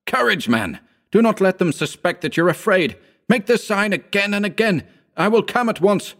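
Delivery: high, urgent cry, not full volume; mild scream of encouragement into a phone; screamed urging. A narrator's reading. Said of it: "I fairly screamed into the receiver".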